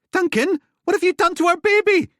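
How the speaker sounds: very high, loud shriek; shrill panic. Acted. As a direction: scream in panic. Ellie shrieking.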